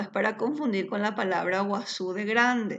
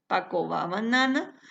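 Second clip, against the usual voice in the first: ordinary talk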